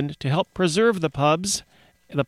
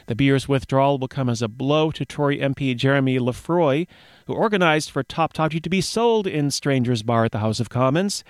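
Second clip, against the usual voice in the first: ordinary delivery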